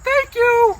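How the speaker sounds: very high, squeaky puppet-like reply; high-pitched comic interviewee; test guest voice. Acted. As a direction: answer in a silly falsetto.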